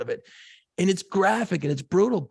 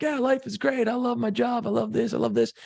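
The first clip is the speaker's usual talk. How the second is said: higher, breezy mock-cheerful voice; mock carefree cheer; 7 semitones higher than usual. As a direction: play the clueless happy guy, lightly. Mocking a carefree outlook.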